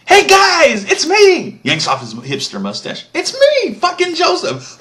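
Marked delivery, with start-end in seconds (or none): 0.1-1.6 much higher, much louder ecstatic cry; joyful reveal shout. Retold or imitated. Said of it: "So he says". 3.1-4.8 much higher, much louder ecstatic cry; joyful reveal shout. Retold or imitated. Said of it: "So he says".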